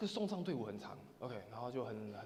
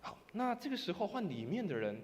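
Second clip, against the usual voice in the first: normal talking voice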